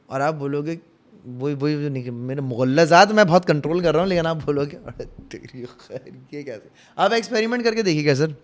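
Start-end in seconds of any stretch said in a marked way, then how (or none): 4.8-6.7 slow, hushed half-swallowed exclamation; low, drawn-out muffled reaction; muttered mock astonishment. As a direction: mutter it softly, holding back a curse. Imagined startled waking, swearing held back.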